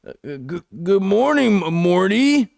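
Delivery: put-on stammering, slurred drunk greeting; drunk Rick. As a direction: stumble over the words like a drunk old man. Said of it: "Rick's drunk as hell".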